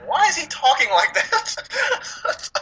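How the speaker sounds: very high, slow, laughing question; mock-baffled laughing squeal; laughing disbelief. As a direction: crack up while asking. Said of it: "I was like".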